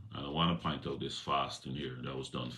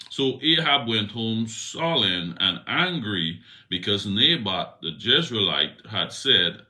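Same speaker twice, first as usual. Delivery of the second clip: scripture reading; 2 semitones higher, 7 dB louder than usual. A narrator's reading. Reads scripture aloud.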